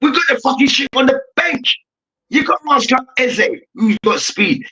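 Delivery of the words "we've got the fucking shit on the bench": higher, exasperated rant at the pundits; frustrated fan outburst. Retold or imitated. Fan shouting back at TV pundits, exasperated and higher. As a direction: voice the fed-up fans arguing back. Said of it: "the fans across the country is getting so frustrated".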